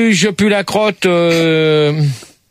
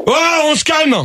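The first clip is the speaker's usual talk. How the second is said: high-pitched, sharp call to order; irritated reprimand; 8 semitones higher than usual. Real, not acted. Host calming callers greeting each other, irritated reprimand. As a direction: cut the chatter off, annoyed.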